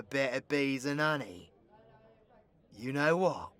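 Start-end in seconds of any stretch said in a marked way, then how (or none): none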